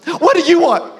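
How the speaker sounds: high, loud mock-eager server; in-your-face waitress. Waitress leaning in to take an order, intensely eager. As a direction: crowd the customer with eagerness. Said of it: "she literally goes like this".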